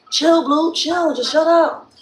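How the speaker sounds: high-pitched mock scolding of a dog; acted telling-off. Owner telling barking dog to chill, acted scolding.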